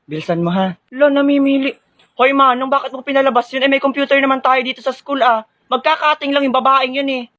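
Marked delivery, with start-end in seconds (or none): 2.2-7.3 indignant protest. Acted. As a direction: call out the guard's favoritism. Student protests to guard. (galit na reklamo sa guwardiya)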